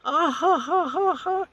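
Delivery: high, rising mock laugh of delight; imitated laugh. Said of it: "And she goes".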